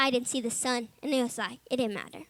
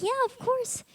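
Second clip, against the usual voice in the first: bright and much higher, instant yes; eager staff reply; 11 semitones higher, slower than usual. Retold or imitated. Staff member happily agreeing to pray, higher pitched. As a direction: chirp it back like it's no question at all. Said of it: "They'll be like".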